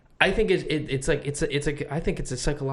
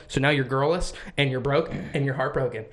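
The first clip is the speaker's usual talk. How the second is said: usual speaking voice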